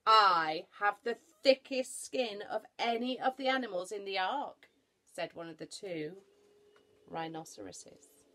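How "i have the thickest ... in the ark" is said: loud, heavy-hitting proclamation; big blustering animal voice; boastful rhinoceros. Acted. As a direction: throw your weight behind every word. Rhinoceros boasts of thick skin.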